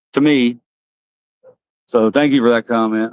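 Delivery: normal talking voice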